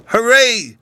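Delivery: high, loud cry of joy; delighted shout. A narrator's reading. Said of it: "he shouted in delight".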